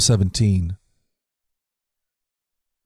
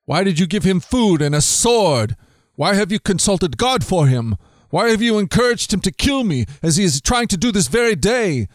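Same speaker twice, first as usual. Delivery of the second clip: higher-pitched, indignant string of questions; demanding king; 7 semitones higher than usual. Acted. Saul demands answers from the priest, a mild acted anger. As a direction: press each question harder. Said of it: "Saul demanded".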